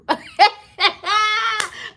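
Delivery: high wailing laugh-cry. Acted. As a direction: burst into a mock sobbing laugh. Laughing wail before the line.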